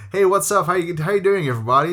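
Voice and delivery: high, loud, chirpy North American greeting; put-on Canadian accent. Teacher greeting pupils in a fake Canadian accent. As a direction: ham up a fake Canadian hello to the class. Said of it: "putting on this".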